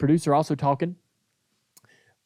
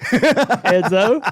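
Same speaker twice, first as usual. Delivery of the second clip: name said higher through laughter; laughing; 8 semitones higher, 4 dB louder than usual. Real, not acted. Host replaying his own flub, laughing. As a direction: crack up on the name. Finishing flubbed line, breaking into laughter.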